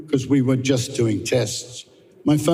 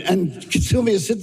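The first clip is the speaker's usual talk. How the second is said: normal talking voice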